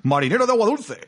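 high hammed-up pirate growl. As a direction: ham up an old sea pirate. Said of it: "lo típico de". (voz de pirata exagerada)

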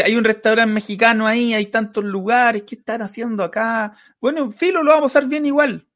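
high-pitched mock reasoning voice; retold pleading reply. Retells own answer to heckling table. (respuesta imitada en voz aguda)

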